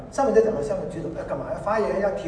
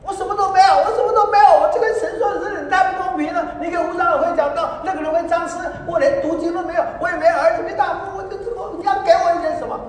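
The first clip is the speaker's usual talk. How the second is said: high, loud, whiny wail to God; wailing complaint; 14 semitones higher, 9 dB louder than usual. Retold or imitated. Sister tearfully complaining to God she has nothing. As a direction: cry out like a woman who has nothing.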